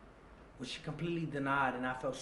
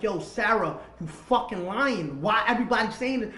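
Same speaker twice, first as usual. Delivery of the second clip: loud, higher-pitched angry accusation; raging; 5 semitones higher, 9 dB louder than usual. Retold or imitated. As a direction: retell the rage loudly, dragging out the curse. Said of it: "I'm, I'm, I'm raging".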